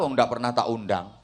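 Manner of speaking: ordinary delivery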